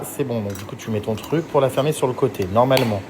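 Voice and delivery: ordinary talk, nothing marked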